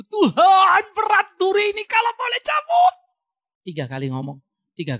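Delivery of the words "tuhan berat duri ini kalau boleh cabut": high, loud pleading cry to God; Paul pleading. Acted. Paul crying out to God about his thorn, loud pleading. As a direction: beg God to take the thorn away. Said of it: "Paulus juga begitu".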